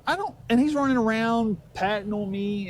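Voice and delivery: ordinary delivery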